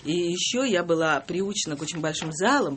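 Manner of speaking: ordinary talk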